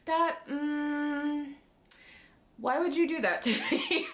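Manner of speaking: high-pitched acted pleading; mock protest. He protests to her about tagging along, mock protest.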